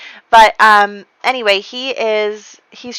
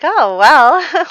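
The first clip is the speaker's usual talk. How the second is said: flattered surprise; 8 semitones higher, 7 dB louder than usual. Retold or imitated. Flattered woman.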